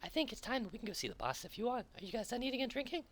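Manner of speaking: very high, quick little cat creature voice; high squeaky cat voice. Acted. GM voicing the little cat guide pointing out the boss, squeaky high. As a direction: squeak it out like a tiny excitable cat. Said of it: "And he goes".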